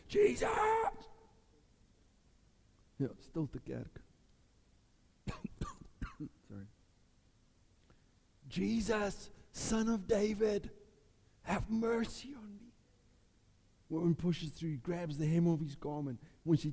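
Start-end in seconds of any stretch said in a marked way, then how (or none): 0.1-0.5 high, sharp cry out; defiant shout. Acted. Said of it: "I'm not gonna keep quiet". 8.5-12.5 high, slow begging plea; pleading cry. Acted. Second take, begging.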